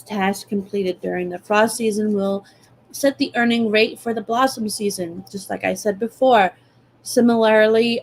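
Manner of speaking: normal talking voice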